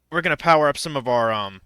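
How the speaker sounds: hiccups. Real, not acted. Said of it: "I don't know why I have the hiccups right now".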